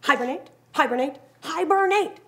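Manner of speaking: building grumpy insistence, last one high and loud; the old grump laying down the law; rising grumpy repetition; grumpy announcement. Acted. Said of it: "announced the old grump".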